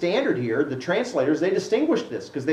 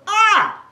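a high mock cry of exasperation, louder; frustrated cry; 8 dB louder than usual. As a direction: cry out like Paul at the end of his rope. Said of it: "And Paul goes".

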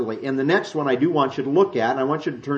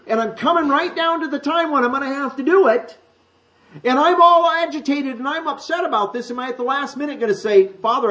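much higher, agitated inner monologue of Jesus; 9 semitones higher, 4 dB louder than usual. Acted. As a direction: voice Jesus wrestling with the coming hour. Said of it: "what he's saying".

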